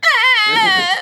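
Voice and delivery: loud high whimpering cry; a baby startled awake, crying out; baby's cry. Retold or imitated. Imitating baby waking.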